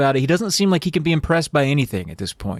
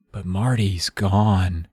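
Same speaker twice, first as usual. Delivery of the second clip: usual speaking voice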